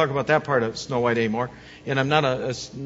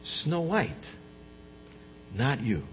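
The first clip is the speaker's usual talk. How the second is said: low, soft blunt verdict; shimmering echoing mirror voice; 4 semitones lower, 10 dB quieter than usual. Retold or imitated. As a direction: deliver the bad news quietly. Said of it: "And the mirror said".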